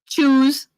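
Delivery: high kiddie "Shoes" starting with CH; child's mispronunciation. Retold or imitated. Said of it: "She can't pronounce it".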